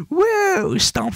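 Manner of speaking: sudden very high yelp; startled whoa. Real, not acted. Gamer yelping in surprise at the game, very high. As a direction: jolt up into a squeal.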